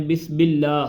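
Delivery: بسم said with a b sound in place of the م; wrong pronunciation. Shown as a demonstration. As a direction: demo the common mistake: بسم with م turned into ب. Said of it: "میم کی جگہ پہ با پڑھ دیتے ہیں".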